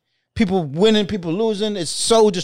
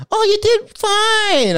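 much higher, slow imitation of mom; mom consoling; much slower than usual. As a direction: reassure your son that he did fine, drawn out. Mom consoling after the bombed set.

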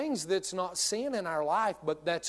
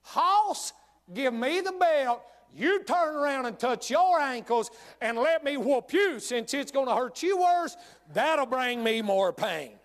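cheeky mock father; 6 semitones higher, 4 dB louder than usual. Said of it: "I always wanted to say".

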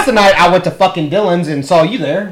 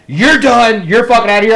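owner ejecting; 6 semitones higher, 4 dB louder than usual. Retold or imitated. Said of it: "she was like".

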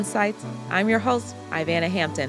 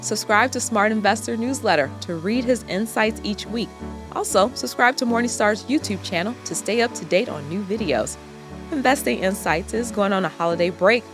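podcast outro read; 11 semitones higher than usual. Acted. Host outro read over music.

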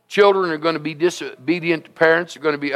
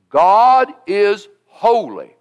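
slow emphatic; 3 semitones higher, 3 dB louder, much slower than usual. Acted. Preacher slows for key line.

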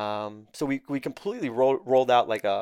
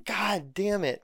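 retold frustration; 8 semitones higher, much slower than usual.

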